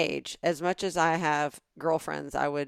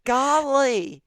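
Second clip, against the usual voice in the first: Southern golly; 6 semitones higher, much slower than usual. Pretend. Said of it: "gives us Southerners a bad name".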